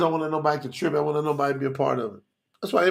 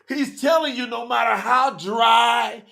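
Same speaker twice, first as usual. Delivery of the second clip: high, driving sermon voice; fervent preaching; 7 semitones higher, slower than usual. Real, not acted. Preacher urging listeners to keep the commandments, fervent and high. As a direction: preach it hard, pitched well up.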